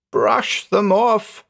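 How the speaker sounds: high, very slow, strained plea; urgent plea. A narrator's reading. Horrified man begging his friend to help, urgent plea. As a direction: draw it out high in dread. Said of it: "he whispered".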